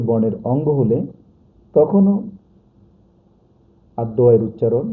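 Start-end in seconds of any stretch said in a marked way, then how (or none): none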